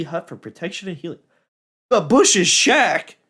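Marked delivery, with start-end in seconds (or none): 1.9-3.2 slow drawn-out delighted question; surprised. Real, not acted. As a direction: stretch it out, amazed. Spots a wanted upgrade.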